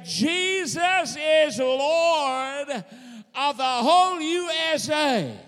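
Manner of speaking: slow, high, loud declaration; proclaiming shout. Acted. Preacher leading the church's proclamation, slow high shout. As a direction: cry it out like a victory.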